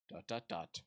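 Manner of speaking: low, soft drowsy read; sleepy. Acted. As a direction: drift off as you read it. Reads sleep text, low and soft.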